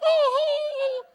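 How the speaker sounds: very high, loud wailing cry; wife sobbing. Retold or imitated. Wife sobbing out of control at the deathbed, high wail. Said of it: "With my wife".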